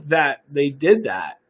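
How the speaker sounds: usual speaking voice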